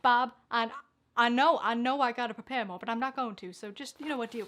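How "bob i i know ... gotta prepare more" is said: ordinary talk, nothing marked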